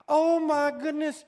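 loud, stern exclamation; loud scolding sigh of disbelief; exasperated father. Acted. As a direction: bark it like an angry dad. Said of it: "We see God as this angry father".

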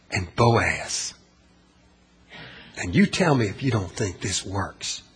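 quiet, deliberate, weighty delivery; hushed challenge. Real, not acted. Preacher challenging the congregation to judge the model of Ruth, hushed. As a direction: drop low and soft, leaving long pauses.

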